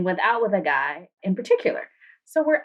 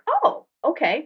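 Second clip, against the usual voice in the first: high "Oh, okay" in retold surprise; mock surprise; 8 semitones higher than usual. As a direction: act lightly surprised at his order. Retelling surprised reaction.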